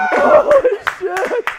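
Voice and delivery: high, stretched Holy shit; real excited cry; elated exclamation. Real, not acted. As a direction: gasp it out in delight. After the chant, elated.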